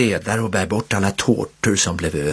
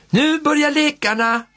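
calling out. A narrator's reading. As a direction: call the kids to the games. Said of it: "ropar faster".

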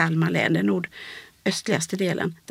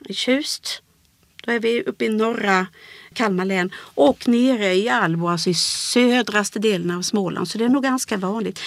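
ordinary talk, nothing marked

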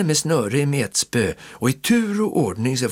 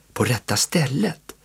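ordinary delivery

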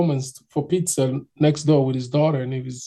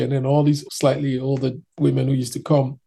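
ordinary talk, nothing marked

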